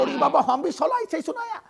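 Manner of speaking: put-on Bengali accent. Beaten Bengali neighbour complains to Raju in a Bengali accent. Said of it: "dada repeats the couplet".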